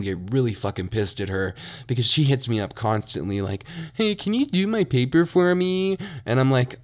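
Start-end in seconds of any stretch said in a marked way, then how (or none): 3.9-6.0 high, sing-song mock of a classmate begging a favor; mimicking the classmate. Mimicking the classmate asking for homework help, high mock plea. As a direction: put on her pleading, high little ask.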